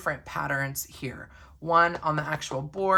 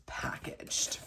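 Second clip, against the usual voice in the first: whispering; 10 dB quieter than usual. Real, not acted. Whispered aside about packaging.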